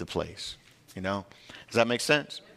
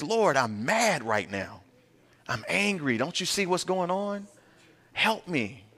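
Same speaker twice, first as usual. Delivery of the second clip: angry crying out; 8 semitones higher, slower than usual. Acted. Believer honestly telling the Lord he is mad, crying out. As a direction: pitch climbing in frustrated appeal. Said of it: "And he's crying out".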